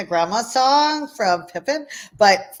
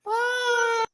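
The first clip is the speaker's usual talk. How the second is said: mock crying goodbye; 7 dB quieter than usual. Playful farewell, whiny.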